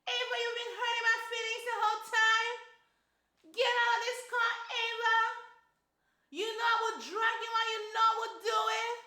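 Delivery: high, loud, fast mock yell of Dallas; hammed-up high-pitched yelling husband; yelling Dallas impression. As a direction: shriek it up high like a man who only yells. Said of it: "I'm talking about the pitch of his voice".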